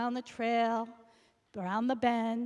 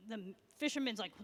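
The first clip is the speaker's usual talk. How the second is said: usual speaking voice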